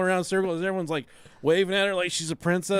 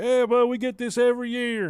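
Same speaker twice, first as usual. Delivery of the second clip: higher, louder offended local; a fair local grumbling about outsiders, higher and louder; hillbilly voice; 7 semitones higher, 4 dB louder than usual. Retold or imitated. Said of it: "he's like".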